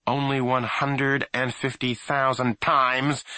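slow sarcastic mother jab; snapping. A narrator's reading. Mother snapping at grandfather's old story, slow sarcasm. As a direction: cut him off with sarcasm. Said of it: "Alfie's mother snapped".

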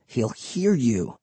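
slow, soft, low warning. A narrator's reading. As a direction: caution her in a hush, drawing it out. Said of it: "Will warned".

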